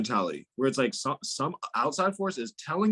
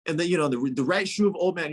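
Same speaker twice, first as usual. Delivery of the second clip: usual speaking voice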